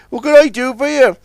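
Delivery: high, hearty boasting; jovial boast. Acted. Billy boasts to the tugs, hearty. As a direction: crow it cheerfully.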